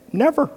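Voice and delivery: one sharp high "Never."; emphatic never. Real, not acted. Preacher insisting scripture never tells husbands this, emphatic. Preacher denies men command submission.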